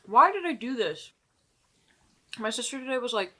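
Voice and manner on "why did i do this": loud lament. Real, not acted.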